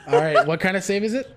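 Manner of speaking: laughing. Real, not acted. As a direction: ask while still laughing. Still laughing.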